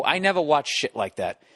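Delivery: usual speaking voice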